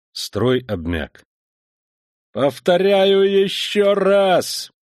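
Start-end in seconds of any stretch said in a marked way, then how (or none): 2.3-4.7 high, loud, slow despairing yell; desperate shout. Acted. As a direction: shout near tears of despair. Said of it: "плачущим от отчаяния голосом прокричал".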